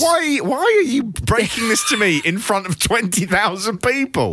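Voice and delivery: high-pitched, scandalised comic objection; mock horrified protest. Acting out horrified Rick Rude.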